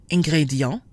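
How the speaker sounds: French "ingrédient". Shown as a demonstration. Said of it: "compare the two words".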